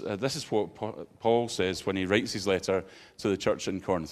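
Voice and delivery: normal talking voice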